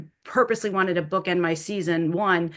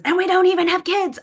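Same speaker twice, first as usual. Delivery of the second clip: exasperated quip; 12 semitones higher, 6 dB louder than usual. Retold or imitated. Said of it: "a look at my husband that says".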